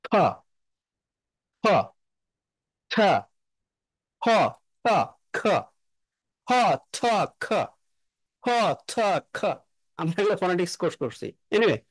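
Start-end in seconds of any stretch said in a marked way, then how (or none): 0.0-9.4 isolated ফ ঠ খ puffs; aspirated consonant sounds. Shown as a demonstration. Teacher shows the aspirated p, t, k sounds. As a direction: breathe out each ফ, ঠ, খ sharply. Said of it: "তারা কি করে?".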